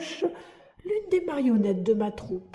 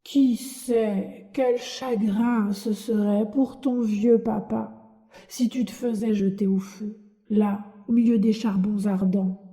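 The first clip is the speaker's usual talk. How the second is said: ordinary delivery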